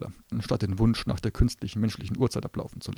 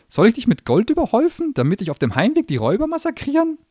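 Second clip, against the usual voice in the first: high, loud mocking gnome voice; sarcastic gnome; 14 semitones higher, 9 dB louder than usual. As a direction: sneer through every offer. Gnome mocks her wishes.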